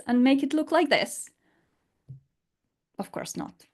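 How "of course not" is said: ordinary talk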